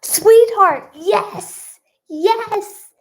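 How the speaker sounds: high, loud burst of glee; excited cheer. Real, not acted. Vlogger cheering a viewer's correct guess, excited. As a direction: cheer the right answer, pitch way up. Guesser gets the charade right.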